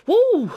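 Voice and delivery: high, loud celebratory "woo"; gleeful high-pitched cheer; excited whoop. Real, not acted. Celebrating getting a castle.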